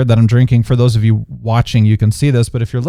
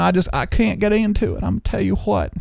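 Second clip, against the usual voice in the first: Southern accent; 7 semitones higher than usual. Shown as a demonstration. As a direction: stretch the vowels into a Southern accent. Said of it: "like, that Southern accent".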